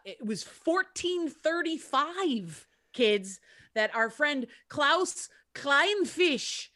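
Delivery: slow, hammed-up German accent; bad German accent. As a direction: drag it out in a mock German accent. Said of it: "we both clearly have rights to do a bad accent".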